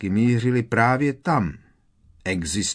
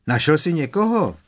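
higher, loud demanding call; sharp shout; 8 semitones higher, 5 dB louder than usual. A narrator's reading. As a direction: bark the question into the trees. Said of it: "křikl pan Skrk".

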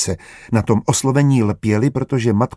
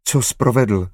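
plain reading voice

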